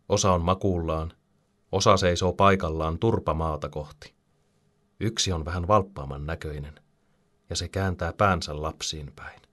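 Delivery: plain reading voice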